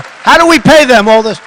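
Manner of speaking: ordinary delivery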